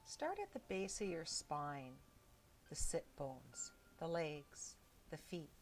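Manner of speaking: soft, unhurried meditation voice with long pauses; hushed body-scan guidance, leaving space between phrases; guided meditation. Real, not acted. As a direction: gently guide them into stillness, phrase by phrase.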